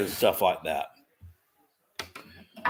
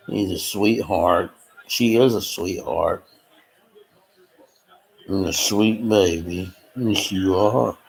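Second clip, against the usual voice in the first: cooing to dog; 5 semitones lower than usual. Real, not acted. Cooing to the pug.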